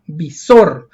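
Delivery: visor with -SOR punched loud, falling; stress on last syllable. Shown as a demonstration. Teacher proving visor is aguda, stressed last syllable. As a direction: hit the end of visor hard. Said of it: "Lleva la mayor fuerza de voz".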